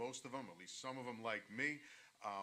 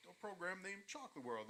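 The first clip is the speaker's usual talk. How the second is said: mock disbelief; 8 semitones higher, 10 dB louder, slower than usual. Retelling students mocking him.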